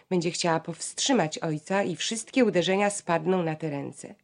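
ordinary delivery

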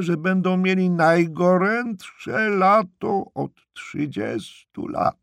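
high, drawn-out yell; shrieked report. A narrator's reading. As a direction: shriek it slowly, stretching the words. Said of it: "wrzasnęła".

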